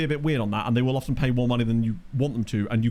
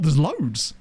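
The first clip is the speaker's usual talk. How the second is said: ordinary delivery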